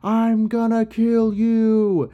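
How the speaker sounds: slow, high-pitched mock bellow, every word stretched; bellowing challenge. Enkidu challenging Gilgamesh before the townspeople, slow mock bellow. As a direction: call out a drawn-out challenge to a rival. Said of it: "Enkidu kinda bellows out".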